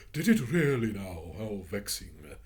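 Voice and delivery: put-on kindly old lady sympathizing. Missus Biddle sympathizing with the children, kindly. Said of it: "said Missus Biddle kindly".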